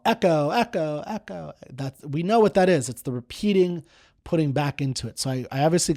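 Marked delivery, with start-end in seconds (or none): 0.0-1.5 mock echo. Teacher imitating a cave echo for students, fading each time. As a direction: call out and let it fade like an echo. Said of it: "you yell".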